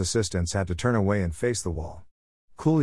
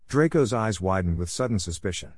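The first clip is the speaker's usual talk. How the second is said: usual speaking voice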